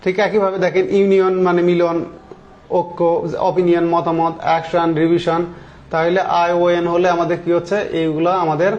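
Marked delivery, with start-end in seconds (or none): none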